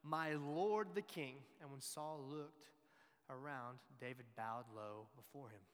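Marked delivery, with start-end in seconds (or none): 0.0-1.3 loud and slow royal address; ringing slow call across the cave; shouted. Retold or imitated. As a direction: call out to the king, slowly and loud. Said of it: "David came out and shouted".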